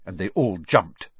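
plain reading voice

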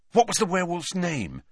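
high, urgent question; quick question. A narrator's reading. Harry pressing friends about the attacker, quick and urgent. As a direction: ask it at once, pressing for the answer. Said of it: "said Harry quickly".

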